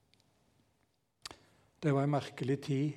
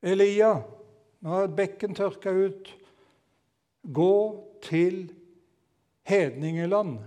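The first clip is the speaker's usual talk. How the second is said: raised, commanding call to Elia; voice of God; 5 semitones higher, 6 dB louder than usual. Acted. Preacher voicing God commanding Elia, loud and high.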